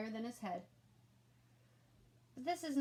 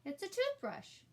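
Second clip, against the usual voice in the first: put-on much higher child voice; matter-of-fact kid; 11 semitones higher than usual. A narrator's reading. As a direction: state it simply. Ian answers.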